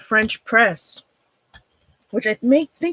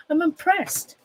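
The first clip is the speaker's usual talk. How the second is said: laughing; 6 semitones higher, 5 dB quieter than usual. Real, not acted. Laughs at perfect circle.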